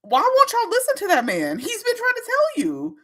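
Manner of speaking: exasperated plea. Real, not acted. Exasperated at audience.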